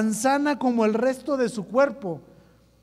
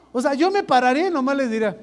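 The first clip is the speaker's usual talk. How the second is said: ordinary talk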